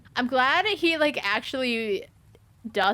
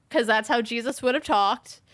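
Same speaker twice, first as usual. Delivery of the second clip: ordinary delivery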